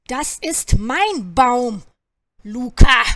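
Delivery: slow, loud territorial snap; quarrelling crow, loud burst on the name; angry crow. A narrator's reading. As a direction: stake your claim loudly, word by word. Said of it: "zischte der eine".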